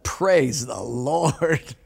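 very slow, drawn-out relief; relieved sigh. Retold or imitated. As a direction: breathe it out like you just escaped death. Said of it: "the man wiped his brow and said".

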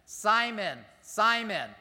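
calling out Simon twice, loud and high. Acted. Jesus calling Simon sharply to get his attention. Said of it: "the Lord said".

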